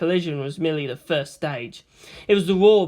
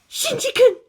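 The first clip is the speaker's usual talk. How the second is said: high, louder furious cry; hissed outburst; 6 dB louder than usual. Acted. Maya snapping at Shinji in rage. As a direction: spit the name out in rage. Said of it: "Maya hissed out".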